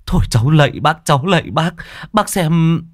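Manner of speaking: high-pitched, repeated pleading; desperate begging. Acted. Husband begs neighbour to take his wife, desperate pleading. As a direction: implore him, voice pitched right up.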